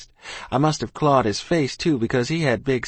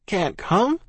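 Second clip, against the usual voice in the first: put-on boy's voice; 9 semitones higher than usual. A narrator's reading. Boy voiced in story.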